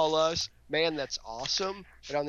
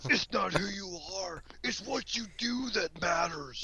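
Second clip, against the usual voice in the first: higher, hammed-up gravel superhero voice; Batman impression; 5 semitones higher, 3 dB quieter than usual. Cohost riffing on the voice, hammed-up Batman growl. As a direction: growl it like a brooding vigilante. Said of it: "talk like Batman".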